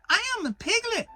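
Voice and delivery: indignant little piglet. A narrator's reading. Piglet insisting on who he is to Kanga, high squeak.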